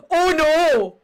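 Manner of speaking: loud, high theatrical wail; blurted shout of dismay; dismayed cry. Retold or imitated. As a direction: react in big mock horror. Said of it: "I go".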